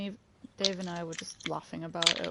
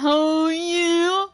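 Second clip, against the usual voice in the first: excited "oh yeah"; 10 semitones higher, 9 dB louder than usual. Shown as a demonstration. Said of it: "Can I get a".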